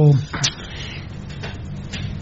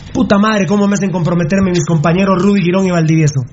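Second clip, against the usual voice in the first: usual speaking voice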